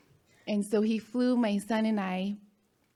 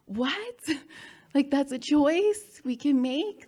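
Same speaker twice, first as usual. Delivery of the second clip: incredulous surprise; 6 semitones higher, 5 dB louder than usual. Retold or imitated. Retold disbelief at choosing happiness.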